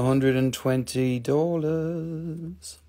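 slow, stretched price call at normal volume; drawn-out price. Real, not acted. Seller offering a piece to buyers, drawn-out price. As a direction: draw the words out slowly, fully voiced.

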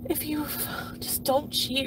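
near-whispered warning to her partner; hushed plea. Retold or imitated. To her cheating husband, a hushed plea.

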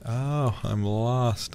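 ordinary delivery

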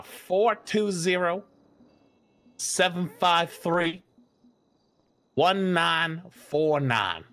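slow, evenly spaced digits; number dictation. Pretend. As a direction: dictate the number slowly with pauses. Reading out a phone number.

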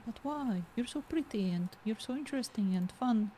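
normal talking voice

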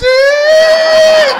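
high, loud mocking "ih"; loud teasing whoop; crowd shout. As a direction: shout it out like the whole room. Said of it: "Todo mundo gritaram".

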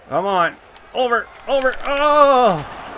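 high urgent over over, then oh; will the puck across; cheering near-goal; urgent excitement. Real, not acted. Urging shot in final seconds.

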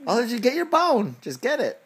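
excited egging on. Real, not acted. Dad urges Oliver to grab his bone, excited. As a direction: cheer the puppy on.

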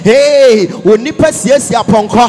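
loud, driving Pentecostal sermon; fervent preacher rousing the congregation; full-force preaching. Real, not acted. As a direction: preach it hard in short, punchy phrases.

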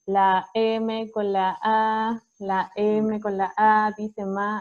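"la M con la A" muy lento, letra por letra con su nombre; deletreo con artículos. Shown as a demonstration. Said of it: "si nosotros decimos". (como se enseñaba antes, "la M con la A" despacio)

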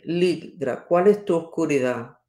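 ordinary talk

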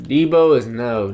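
ordinary talk, nothing marked

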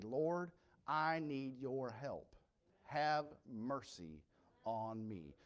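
very slow, soft plea for mercy; slow pleading prayer. Acted. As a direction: beg the Lord quietly, drawing it out. Sinner's plea acted out.

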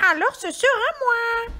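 high-pitched squawking hen; little hen voice. A narrator's reading. Hen deciding to do it herself, high hen voice. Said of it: "dit la petite poule rousse".

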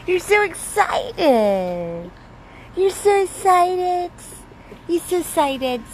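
gushing to an excited dog; excited pet talk. Real, not acted.

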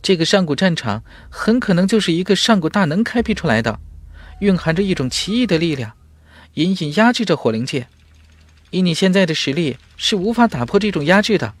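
high, quick spirit voice speaking inside the mind; a lively high-pitched spirit explaining urgently to its master; high fast spirit voice; fire spirit voice. Acted.

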